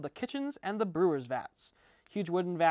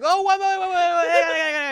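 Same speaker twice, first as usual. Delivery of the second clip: high, loud, flustered cries to wait; a startled man begging them to hold on; frantic startled plea from behind the door; startled panic; 10 semitones higher, 11 dB louder than usual. Acted. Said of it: "And so he's like".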